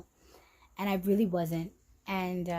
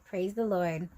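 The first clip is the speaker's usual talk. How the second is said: normal talking voice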